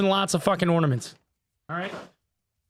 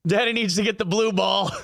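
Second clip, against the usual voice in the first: a hammed-up yell about the blue ball; jokey shout of a ball-hungry daddy; mock shout; 2 semitones higher than usual. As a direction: bellow it like a greedy dad. Joking shout about the prize ornament.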